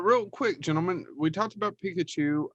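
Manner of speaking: ordinary delivery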